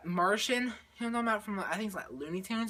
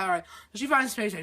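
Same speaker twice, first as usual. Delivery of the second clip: usual speaking voice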